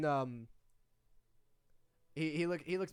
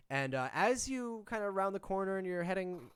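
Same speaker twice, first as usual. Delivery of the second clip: ordinary talk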